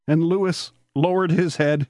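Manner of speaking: usual speaking voice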